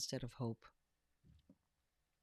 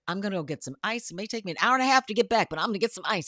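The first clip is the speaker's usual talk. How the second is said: hasty husband's excuse; 3 semitones higher, 9 dB louder, much faster than usual. Retold or imitated.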